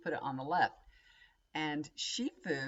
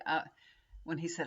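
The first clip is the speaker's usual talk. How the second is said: ordinary delivery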